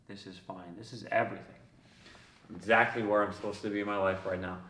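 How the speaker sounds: low, very soft inner voice; serene, intimate realization; hushed acceptance. Retold or imitated. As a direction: murmur it with quiet certainty. Retold moment of sudden acceptance.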